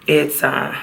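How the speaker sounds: usual speaking voice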